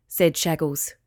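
ordinary delivery, nothing marked

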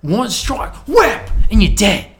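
put-on excited Australian accent; loud Steve Irwin. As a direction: bellow the danger like a croc hunter. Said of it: "I'm Steve Irwin".

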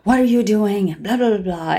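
lightly mocked telling-off; retold yelling. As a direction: imitate a scolding work mom. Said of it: "work mom's yelling at me".

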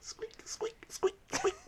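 tiny high breathy squeaks; mouse squeak. Acted. GM voicing the mouse with soft high squeaks. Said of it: "says the mouse".

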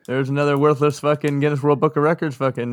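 ordinary delivery

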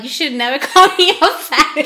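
loud, high, through laughter; laughing retold outburst. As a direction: cry it out while cracking up.

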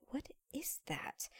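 slow, whispered bafflement; hushed puzzlement. Retold or imitated. Teenage hostess puzzling over the specials board, whispering. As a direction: whisper it to yourself, word by word. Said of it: "I'm like".

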